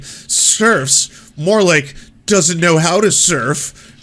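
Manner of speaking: high, loud, drawn-out corny punchline; mock dad joke. Mocking the Jimmy Dore crowd's corny dad-joke replies. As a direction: ham up the groaner pun. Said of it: "it's like".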